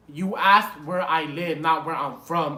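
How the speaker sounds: LIVE and FROM set against each other, loud; pointed retort. Retold or imitated. Friend retells correcting the cop on the question, pointed retort.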